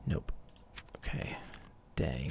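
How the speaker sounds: whispered letdown; quiet muttered dang. Real, not acted. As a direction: sigh it out in a whisper. Whispered disappointment over stats.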